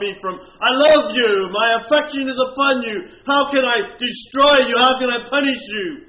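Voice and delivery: preacher voicing God's lament; preaching at full force. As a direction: cry out God's pleading words.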